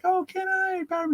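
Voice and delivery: put-on an excited little kid asking; eager kid. Kid asks in story.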